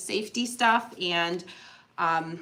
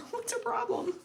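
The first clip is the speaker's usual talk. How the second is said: high, giggling through the words; giggly high echo of the jab; laughing repeat; 13 semitones higher, 9 dB quieter than usual. Retold or imitated. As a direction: laugh at the viewers' judgment. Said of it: "People are like".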